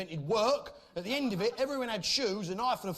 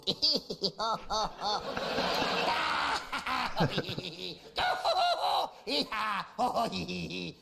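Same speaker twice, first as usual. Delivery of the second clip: mock Irish gibberish noises; 3 semitones higher than usual. Comic mimicking Ireland the cheeky sidekick, mock wordless cackling. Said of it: "is that how you communicate".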